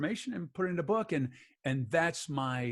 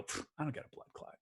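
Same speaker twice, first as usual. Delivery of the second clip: dismissive scoff; 13 dB quieter, faster than usual. Retold or imitated. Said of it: "I go".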